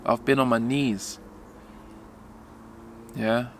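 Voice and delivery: ordinary delivery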